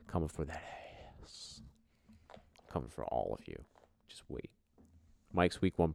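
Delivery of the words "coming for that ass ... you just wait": a hint of a low, soft threat; mock menacing quiet. Taunting league rival Mike, mock menacing and quiet.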